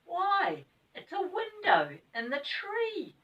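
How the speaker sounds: high, slow amazed exclamation; astonished discovery; astonishment. Acted. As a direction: gasp it out in wonder. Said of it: "said Bessie in astonishment".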